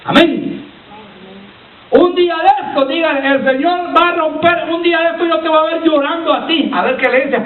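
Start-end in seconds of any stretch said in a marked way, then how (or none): 1.9-6.7 high, sustained preacher's call; exhorting preacher, pitched up; forceful preaching. Acted. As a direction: ring it out to the congregation on a raised pitch. Preaches a challenge to congregation.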